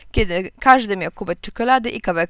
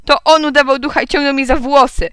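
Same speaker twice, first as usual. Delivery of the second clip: put-on loud, high childish blaming; shouted accusation; 5 semitones higher, 6 dB louder than usual. A narrator's reading. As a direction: tell on him at full voice. Girl keeps shouting her accusation. (głośne, wysokie oskarżenie)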